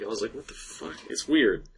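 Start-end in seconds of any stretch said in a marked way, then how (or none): 0.3-1.0 very soft, voiceless disbelief; near-whispered aside of disbelief; stunned mutter. Retold or imitated. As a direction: say it under your breath, baffled. Retells own stunned reaction.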